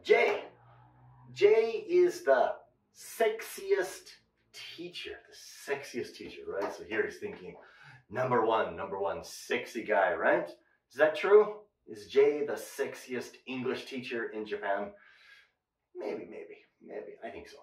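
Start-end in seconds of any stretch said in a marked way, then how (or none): 1.3-5.1 slow model sentence. Shown as a demonstration. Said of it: "slow model sentence". 15.9-17.6 coy whisper. Acted. Coy self-joke.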